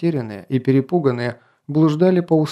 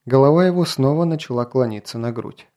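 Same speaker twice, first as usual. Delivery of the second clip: plain reading voice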